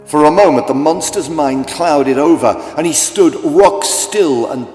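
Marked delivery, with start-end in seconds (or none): none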